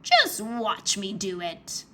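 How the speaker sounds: slow, sly villain voice; evil grin. A narrator's reading. Maldred taunting Drake, a slow sly villain voice. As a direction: savour every word like a gloating villain. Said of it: "Maldred said with an evil grin".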